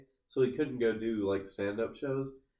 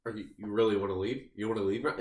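rapid-fire imitation of a pushy husband; fast talking; faster than usual. Husband challenging his pregnant wife, imitated in fast talk. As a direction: fire the questions off quickly, imitating him. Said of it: "this is how fast he's talking".

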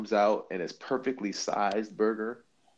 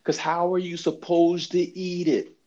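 exasperated; 7 semitones higher, slower than usual. Real, not acted. Exasperated rhetorical question.

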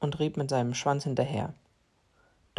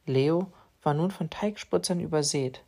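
ordinary delivery

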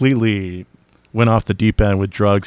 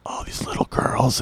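breathy, creepy little whisper; 4 semitones higher, 8 dB quieter than usual. Retold or imitated. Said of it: "where he's like".

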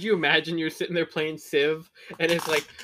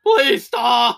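loud, higher shout; mock pained shout; 8 semitones higher, 8 dB louder than usual. Gandhi's victim begging barbarian, mock shout. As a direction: cry out in mock pain.

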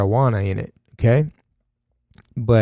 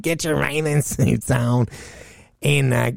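imitating her mom; 4 semitones higher, 4 dB quieter than usual. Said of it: "She's like".

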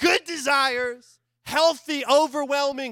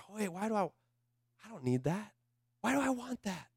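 much softer, to yourself; hushed inner voice; quiet self-questioning; 12 dB quieter, faster than usual. Retold or imitated. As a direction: murmur it as an inner thought. Inner monologue questioning desire.

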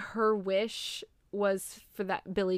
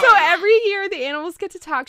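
louder, higher and faster; excited near-shout; 9 semitones higher, 12 dB louder, faster than usual. Real, not acted. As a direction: burst out with it. Emotional climax of retold plot.

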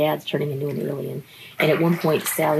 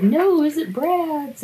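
higher, slow pleading question; 11 semitones higher, slower than usual. Retold or imitated. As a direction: plead up high, slowly. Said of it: "Sally's like".

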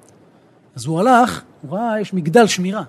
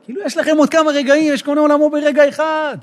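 urgent plea; 8 semitones higher, 5 dB louder than usual. Retold or imitated. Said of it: "התחיל לבכות".

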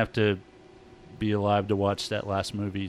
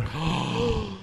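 gasp; 10 semitones higher than usual. Retold or imitated. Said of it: "and you're like".

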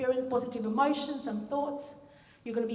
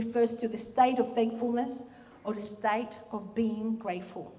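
ordinary talk

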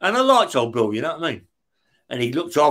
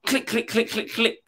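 soft clicking sounds, much softer; mock typewriter clicks; 5 semitones higher, 6 dB quieter than usual. Retelling the noise from upstairs at night, soft mock clicks. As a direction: tap out the clicks under your breath.

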